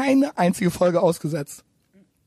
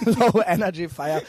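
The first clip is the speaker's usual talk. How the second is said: laughing. Real, not acted. Laughing at the mock name.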